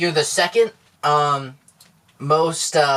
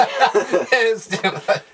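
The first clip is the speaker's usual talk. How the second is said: laughing; 2 semitones higher, much slower than usual. Real, not acted. Co-host laughing along.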